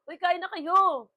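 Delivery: loud, slow call to everyone. Real, not acted. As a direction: call out to the family to eat. Calling out to others. (malakas na pagtawag)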